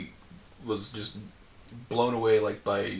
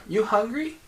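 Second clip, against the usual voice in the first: put-on high-pitched small child; little girl voice; 12 semitones higher, 5 dB louder than usual. Little girl offering part of her sandwich, childlike. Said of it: "little girl voice".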